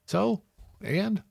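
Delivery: high-pitched "so what" retort; imitated dismissive reaction. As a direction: act out an unimpressed listener. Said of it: "his reaction was like".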